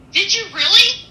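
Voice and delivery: high, loud amazed question; amazed teenage girl. Retold or imitated. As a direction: play the stunned teen who can't believe it. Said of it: "and she goes".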